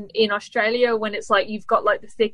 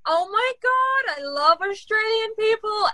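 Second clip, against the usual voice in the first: gushing cashier; 10 semitones higher than usual. Retold or imitated. Guest mimics the cashier lady who thinks she's Australian, high gush. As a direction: imitate a starstruck cashier, pitched way up. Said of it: "the cashier lady was like".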